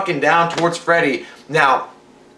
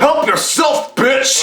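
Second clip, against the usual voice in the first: villain taunt; 9 semitones higher, much slower than usual. Retold or imitated. Host imitating Freddy taunting a teen at the party, as a high gleeful snarl. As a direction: spit the taunt out as a gleeful slasher. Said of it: "he's like".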